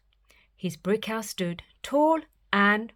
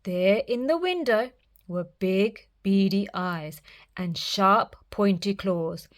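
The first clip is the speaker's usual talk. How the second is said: usual speaking voice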